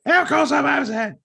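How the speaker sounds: high, loud indignant outburst; mock outraged yell. Karen yelling at a calm stranger, high and loud. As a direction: blow up like an offended Karen. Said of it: "You seem to be upset".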